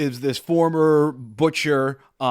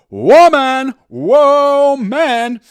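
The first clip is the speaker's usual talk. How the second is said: quoting beat poem; 11 semitones higher, 12 dB louder, much slower than usual. Retold or imitated.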